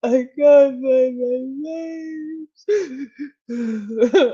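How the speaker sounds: high, slow, tearful wail breaking into laughter; hammed-up sobbing, drawn out and laughing; mock crying wail. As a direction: imitate yourself sobbing down a voice message. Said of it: "audio message of me just going".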